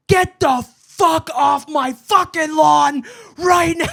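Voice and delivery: high, loud, slow furious yell; screaming mom. Retold or imitated. Mom screams at the other moms, furious and high. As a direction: scream them off the lawn. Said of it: "started screaming at them".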